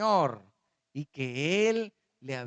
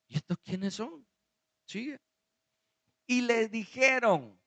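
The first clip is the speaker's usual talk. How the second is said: ordinary talk, nothing marked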